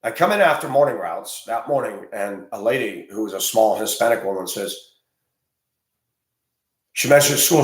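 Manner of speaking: ordinary delivery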